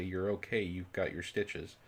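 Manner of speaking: usual speaking voice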